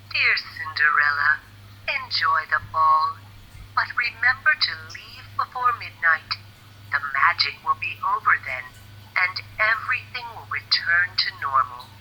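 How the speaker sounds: lightly voiced fairy godmother, a little hushed; a gentle hint of a kindly fairy godmother giving a warning. Fairy godmother warns Cinderella before the ball, lightly voiced and hushed.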